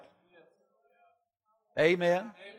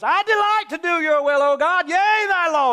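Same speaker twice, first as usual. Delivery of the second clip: high, loud, full-throated shout of praise; shouting a vow to God at the top of his lungs; hollering at the top of his voice; 13 semitones higher, 9 dB louder than usual. Retold or imitated. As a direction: belt the scripture out as loud as you can. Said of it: "You holler at the top of your voice".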